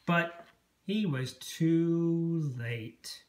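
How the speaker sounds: ordinary delivery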